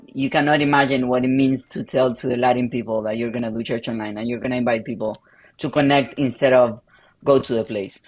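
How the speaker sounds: usual speaking voice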